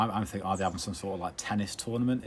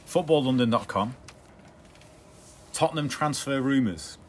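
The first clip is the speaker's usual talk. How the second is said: slow announcer headline read; 4 semitones higher, 6 dB louder, slower than usual. Shown as a demonstration. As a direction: read it out like a news bulletin. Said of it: "get your chops around this one".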